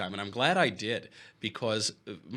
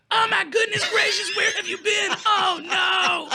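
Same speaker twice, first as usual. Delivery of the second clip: very high squeaky shrieking panic, louder; mock fiancee voice; 7 dB louder, slower than usual. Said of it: "she goes".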